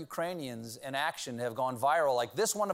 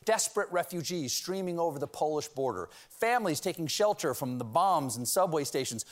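ordinary delivery

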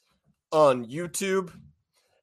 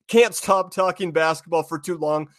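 ordinary talk, nothing marked

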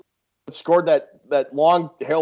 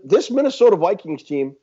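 usual speaking voice

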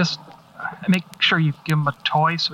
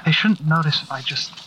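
ordinary delivery

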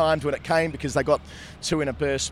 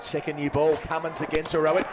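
cricket commentator; 7 semitones higher than usual. Acted. Live TV wicket commentary.

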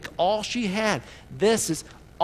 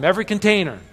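said louder, with more push; louder call-back; 3 semitones higher, 6 dB louder, faster than usual. Shown as a demonstration. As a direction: pastor asks the crowd to repeat, louder. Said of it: "One more time".

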